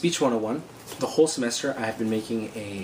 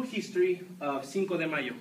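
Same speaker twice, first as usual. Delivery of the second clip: put-on Mexican accent, softer; fake Mexican accent; 4 semitones higher, 6 dB quieter than usual. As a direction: say it as a Mexican foreigner would. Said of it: "my accent is in fact fake".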